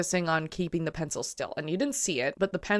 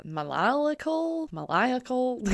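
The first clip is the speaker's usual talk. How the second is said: maniacal stretched slowly and pitched up high; drawn-out maniacal; 7 semitones higher, slower than usual. Shown as a demonstration. Stretching maniacal out to show the laugh. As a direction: linger on maniacal, high and slow. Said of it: "a little bit more ma".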